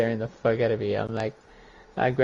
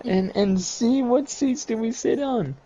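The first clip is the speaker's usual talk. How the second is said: higher mock exasperation at a friend; a raised, teasing snap; mock exasperated; 11 semitones higher than usual. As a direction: toss it back like an annoyed buddy. Retort to masked friend voiced.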